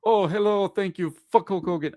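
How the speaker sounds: high, cheery put-on greeting voice; Sheik greeting. Host imitates the Sheik greeting fans at conventions, cheery. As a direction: chirp the hello, drop the curse in. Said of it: "that was his greeting".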